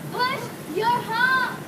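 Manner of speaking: drawn out. Real, not acted. Shouting at passing cars.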